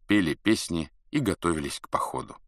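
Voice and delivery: ordinary delivery, nothing marked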